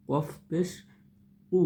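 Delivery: usual speaking voice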